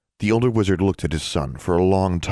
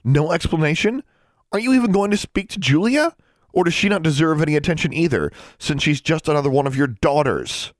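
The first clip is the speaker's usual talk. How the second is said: higher, louder angry questions; angry confrontation; 10 semitones higher, 3 dB louder than usual. Acted. Daughter confronting her father about her sister, angry. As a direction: pile the accusations on him.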